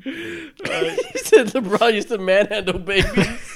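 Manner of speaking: slow, words stretched by laughter; slow laughing retell. Real, not acted. Repeating a friend's joke, slow and laughing. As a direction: retell the line while still laughing.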